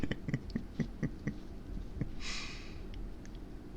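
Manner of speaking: long, soft trailing laugh; amused laughter. Real, not acted. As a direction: laugh quietly till it fades out. Laughs at the outfit just described.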